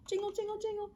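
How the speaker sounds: high, soft bell-like jingles; small, tinkling high voice; jingling bells. A narrator's reading. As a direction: chime it like distant sleigh bells. Imitates distant sleigh bells.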